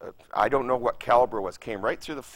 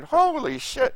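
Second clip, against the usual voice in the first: high, loud holy shit in alarm; shocked exclamation; 13 semitones higher, 6 dB louder, slower than usual. Retold or imitated. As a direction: blurt it out in shock. Shocked thought at gunfire.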